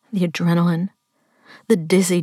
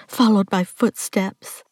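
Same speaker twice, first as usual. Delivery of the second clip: plain reading voice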